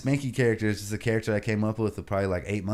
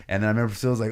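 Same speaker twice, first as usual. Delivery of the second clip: usual speaking voice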